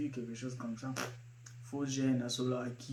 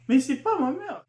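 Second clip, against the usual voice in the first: mock protest; 11 semitones higher, 7 dB louder than usual. Retort to girlfriend intro joke.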